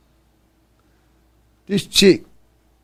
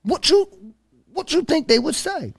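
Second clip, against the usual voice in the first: stern confrontation; 8 semitones higher, 5 dB quieter than usual. Retold or imitated. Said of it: "I said to her".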